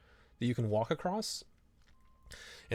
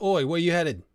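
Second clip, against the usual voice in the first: a hint of a rough English bandit; 5 semitones higher, 6 dB louder than usual. Bandit stopping travellers on the road, lightly rough English. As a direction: hail them as a toll thug. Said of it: "these guys are like".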